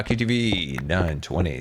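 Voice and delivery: usual speaking voice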